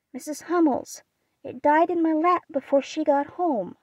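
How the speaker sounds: high, distressed outburst without real sobbing; understated sob-story delivery of a grieving girl; tearful cry. A narrator's reading. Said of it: "cried Beth with a sob".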